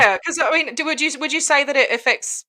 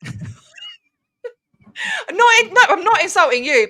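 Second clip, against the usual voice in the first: laughing; 9 semitones higher, 4 dB louder than usual. Real, not acted. Laughing apology.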